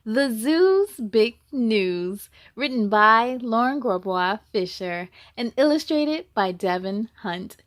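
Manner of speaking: loud, slow, grand title announcement; storybook title reading. Acted. Reading the title aloud to young listeners, slow and grand. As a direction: present the title like opening a show.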